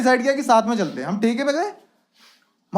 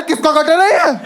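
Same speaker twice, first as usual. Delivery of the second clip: loud, raised-pitch indignant question; outraged demand; 4 semitones higher, 6 dB louder, slower than usual. Acted. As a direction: demand it like an appalled shopkeeper. Uncle reacts to stinking mattress. (भड़ककर ऊँची आवाज़ में सवाल)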